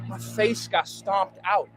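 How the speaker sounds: slow, heavy, each word weighed; slow it down and lean on "face"; slow emphasis. Real, not acted. Woman recounting her beating, slow and heavy.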